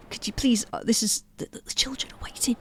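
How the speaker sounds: hushed whisper. Acted. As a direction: whisper it urgently. Santa hushing about the children.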